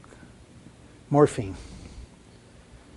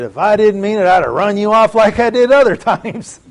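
spirited quote; 6 semitones higher, 9 dB louder than usual. Retold or imitated. Mom's reply voiced, laughing.

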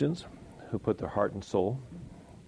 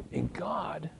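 whispered; 4 semitones higher, 6 dB quieter than usual. Real, not acted. Hushed devotional aside.

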